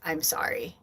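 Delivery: soft, low, heartfelt apology; genuine sorry. Retold or imitated. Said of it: "a very genuine".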